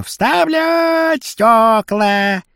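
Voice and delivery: high, long-drawn hawker's yell; unpleasant street cry. Acted. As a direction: stretch it out like a street hawker calling. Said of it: "орёт неприятно". (протяжный неприятный крик)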